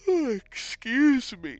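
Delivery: words stretched through a yawn. Real, not acted. Tired streamer yawning mid-commentary. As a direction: yawn your way through the apology. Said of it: "Excuse me for all the yawning".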